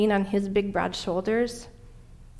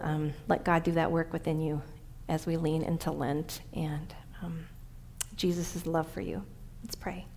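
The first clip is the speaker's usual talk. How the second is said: much softer, sinking toward prayer; hushed closing; 3 semitones lower, 8 dB quieter than usual. Real, not acted. Preacher leads congregation from sermon into prayer, hushed closing. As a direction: let the voice drop away gently, low in volume.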